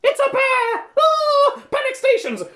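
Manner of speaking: very high squeaky panic; panicked cry. Acted. Little bees crying out at the bear attack. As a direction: shriek it like tiny frightened bees. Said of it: "they cried".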